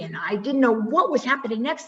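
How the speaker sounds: normal talking voice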